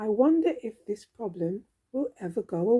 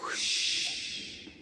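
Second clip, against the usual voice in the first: whoosh; 7 dB quieter than usual. Acted. Whoosh sound effect.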